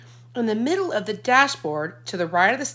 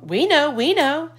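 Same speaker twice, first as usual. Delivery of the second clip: playing along; 8 semitones higher, 5 dB louder than usual. A narrator's reading. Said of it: "played along".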